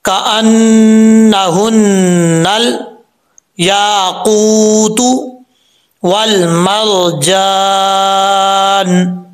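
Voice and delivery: very slow melodic tajweed chant; Quranic recitation. Shown as a demonstration. Teacher reciting the full verse with a pause at the end, chant. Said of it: "अगर वक्फ करें तो".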